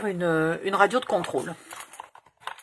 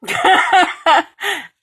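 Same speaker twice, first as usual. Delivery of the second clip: loud laughter; 12 semitones higher, 12 dB louder than usual. Real, not acted. Laughing at her own story.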